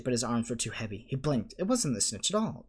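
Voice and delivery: ordinary delivery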